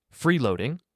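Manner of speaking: high, loud, outraged echo; indignant one-word question, voice jumping up; almost a shout. A narrator's reading. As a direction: throw the word back in disbelief, nearly shouting. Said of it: "came out as almost a shout".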